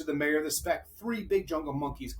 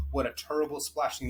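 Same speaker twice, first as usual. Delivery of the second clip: ordinary delivery, nothing marked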